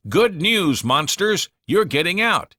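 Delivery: high, loud cheerful call; booming announcement. A narrator's reading. General bursting into the prison with good news.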